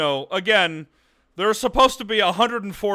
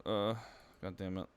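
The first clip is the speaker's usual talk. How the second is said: ordinary delivery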